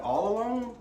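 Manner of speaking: high, loud mock-innocent question; imitating a teasing dad; dad's teasing pun. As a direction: twist her words back at her, playfully. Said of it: "He was like".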